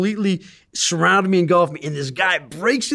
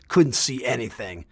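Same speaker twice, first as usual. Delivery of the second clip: usual speaking voice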